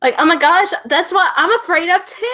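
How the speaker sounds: put-on high, gushing Valley Girl reply. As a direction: gush along like a Valley Girl. Joins in the Valley Girl bit.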